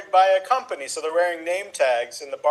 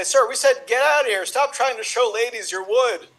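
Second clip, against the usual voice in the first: higher-pitched exasperated bar staff; mock indignation; 6 semitones higher than usual. Bartender turning away a returning golfer, mock indignant. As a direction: play a fed-up bartender, pitched up.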